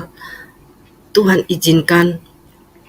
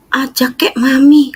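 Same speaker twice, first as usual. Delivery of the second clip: quoting mom; 7 semitones higher, 3 dB louder than usual. Retold or imitated.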